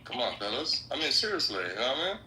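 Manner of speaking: usual speaking voice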